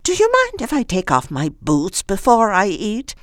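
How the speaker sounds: high, lightly voiced old woman. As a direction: chirp it like an eccentric old lady.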